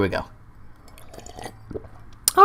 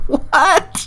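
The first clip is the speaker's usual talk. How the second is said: squeaky what. Acted. Mock bewildered rant.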